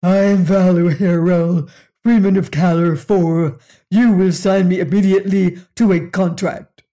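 loud, booming alien growl; big hairy alien growling demands. Acted. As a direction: bark it out like a nine-foot brute. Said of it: "and growled".

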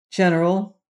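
a touch of firmness; firm. A narrator's reading. Rainsford addressing his host the general, firmly. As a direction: address him firmly, with restraint. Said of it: "said Rainsford firmly".

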